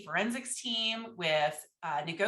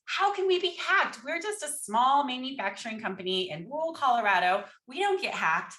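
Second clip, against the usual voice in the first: panicked disbelief; 4 semitones higher than usual. Retold or imitated. CEO hearing her company was hacked, panicked and much higher. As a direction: replay the moment panic hit, pitch up high. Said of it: "In an equally panicked voice".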